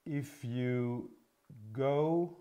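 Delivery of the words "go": ordinary talk, nothing marked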